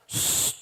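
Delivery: long drawn "shh"; hushing. Acted. Elder hushing someone who called the child naughty, hushing. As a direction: hush them gently before correcting.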